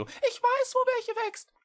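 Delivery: put-on high, eager young creature voice; small cat voice. A narrator's reading. Echo telling the witch he knows, eager little cat. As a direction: speak up keenly, like a tiny cat.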